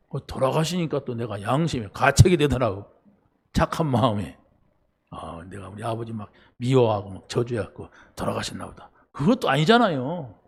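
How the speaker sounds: ordinary talk, nothing marked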